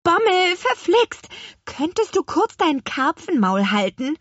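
high, loud, annoyed witch; exasperated scolding. A narrator's reading. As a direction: tell off a chattering fish. Hexe schimpft den Karpfen aus. (aufgebracht schimpfend)

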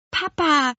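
put-on high anxious child call; urgent call to dad. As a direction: tug at his sleeve with your voice. Girl calls her father.

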